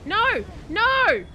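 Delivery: loud, high panicked "No!"; alarmed shout. Real, not acted. Streamer yelling at his game, alarmed. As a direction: yell as your character falls. Player dying in Dark Souls.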